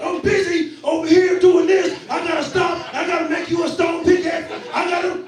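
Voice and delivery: put-on loud impatient kid yell; exasperated child shouting at dad. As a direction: shout it like a busy kid interrupted. Son yelling at dad in Minecraft.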